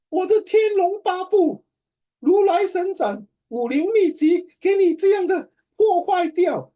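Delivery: 夸张地大声哀嚎; mock wail. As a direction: wail as if your treasured martial-arts manual is destroyed. Mock wail over ruined book.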